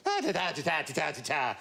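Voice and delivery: bouncy mock-Irish nonsense syllables; comic diddly Irish babble; Irish gibberish. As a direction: rattle out sing-song Irish-accented gibberish. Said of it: "sort of Irish gibberish".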